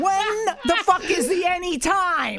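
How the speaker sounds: high, loud, exasperated rant; heated rant. Real, not acted. Ranting at the network about the recording schedule, exasperated. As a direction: vent about the schedule, building up.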